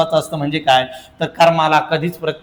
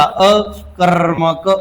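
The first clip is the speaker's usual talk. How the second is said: drawn-out term; much slower than usual. Shown as a demonstration. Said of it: "teacher stretches term".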